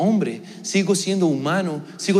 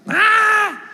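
high, loud sustained "aah" scream; imitated child's scream; 5 dB louder than usual. Dad imitating his son left alone at bedtime, high scream. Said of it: "empezó a gritar".

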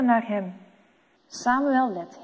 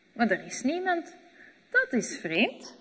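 ordinary delivery, nothing marked

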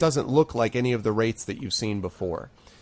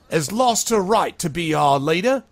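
louder, slightly raised public accusation; accusing speech; 7 semitones higher, 6 dB louder than usual. Acted. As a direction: point at her and denounce. Sharky denounces the mayor.

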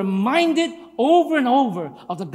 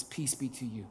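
gentle greeting; 8 semitones lower, 15 dB quieter than usual. Acted. Risen Jesus greeting gently.

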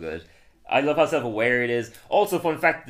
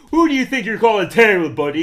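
higher and louder, a hammed-up huffy retort; mock indignant; 7 semitones higher, 10 dB louder than usual. Homer retelling, hammed-up indignant comeback. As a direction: puff up, offended. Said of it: "He's like".

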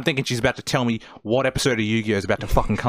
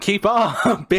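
higher, louder snappy comeback; mock impatient retort; 9 semitones higher, 5 dB louder, slower than usual. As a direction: jokingly scold a slow listener. Mock retort to slow American waitress.

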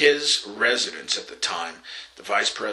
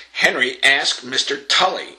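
curt, higher and louder, clipped demand; short curt voice; 5 semitones higher, 6 dB louder than usual. Retold or imitated. Wife curtly ordering her husband to ask the agent. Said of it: "in rather a short, kind of curt voice".

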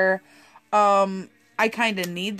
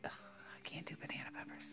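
disgusted whisper; 2 semitones lower, 25 dB quieter than usual. Real, not acted.

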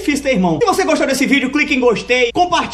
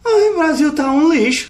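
high, slow whiny complaint; drawn-out mocking whine; whiny sibling; 8 semitones higher than usual. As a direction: whine it like a bratty kid. Sibling whining about Brazil.

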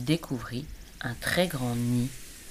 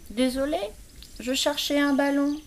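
plus haut, plus fort, confus; ourson désolé; 12 semitones higher, 4 dB louder than usual. A narrator's reading. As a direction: excuses d'ourson gêné. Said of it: "expliqua-t-il". (l'ourson s'excuse auprès de l'écureuil)

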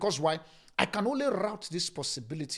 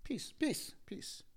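soothing; 7 semitones higher than usual. Acted. Preacher soothing the congregation.

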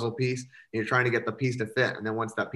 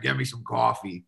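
lightly voiced gruff old smoker, dad impression; a hint of a chain-smoking dad ordering coffee; imitating dad's smoker voice. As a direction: son mimics his dad demanding coffee in a mild smoker voice. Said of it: "he sounds like he eats, like, Marlboro cigarettes for breakfast".

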